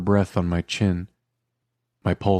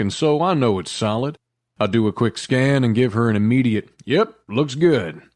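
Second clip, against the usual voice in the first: 1 chatty author aside; 4 semitones higher, 4 dB louder than usual. Real, not acted. Author's note after excerpt.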